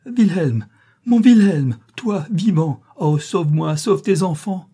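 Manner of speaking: high, loud, pleading cries; desperate cry. A narrator's reading. Wife throwing herself into her husband's arms, desperate cry. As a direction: cry out for rescue in anguish.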